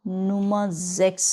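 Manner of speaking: slowly sounding out নুমাজেক্স syllable by syllable; sounding out a word. Shown as a demonstration. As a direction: spell out নুমাজেক্স slowly, puzzling over it. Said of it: "নুমাজেক্স হচ্ছে কি জিনিস?". (ধীরে ধীরে শব্দটা উচ্চারণ)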